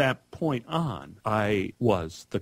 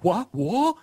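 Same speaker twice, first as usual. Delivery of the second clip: put-on much higher, bewildered little boy; stunned disbelief; 11 semitones higher than usual. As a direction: squeak out the confusion of a wronged child. Child stunned by the accusation.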